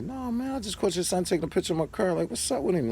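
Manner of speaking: high, quick, disbelieving complaint; telling the neighbor's dad what his son did, high, quick and exasperated; high-pitched exasperation. Retold or imitated. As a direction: rush it out, high and fed up.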